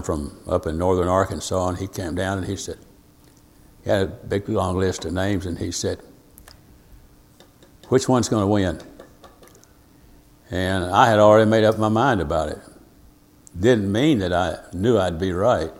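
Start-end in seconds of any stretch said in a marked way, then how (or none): none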